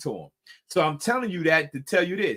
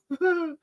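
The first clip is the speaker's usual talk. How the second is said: laugh; 13 semitones higher than usual. Real, not acted. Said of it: "that's funny".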